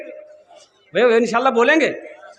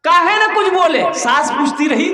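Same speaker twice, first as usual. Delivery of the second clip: higher, louder motherly telling-off; acted scolding; 5 semitones higher, 4 dB louder than usual. Mother scolds son for staying silent, reproachful. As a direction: burst out in a pitched-up reproach.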